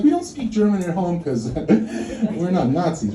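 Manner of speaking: ordinary talk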